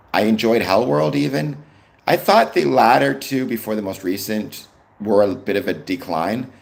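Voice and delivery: ordinary talk, nothing marked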